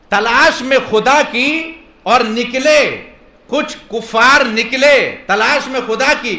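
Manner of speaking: high, loud, drawn-out declamation; rousing preaching. Acted. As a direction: proclaim it slowly to a big crowd.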